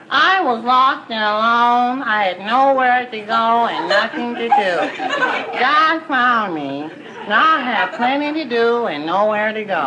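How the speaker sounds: high, very slow testimony voice; radio woman confessor. Retold or imitated. Woman confessor testifies on the radio, high and slow. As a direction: confess slowly, like a saved woman.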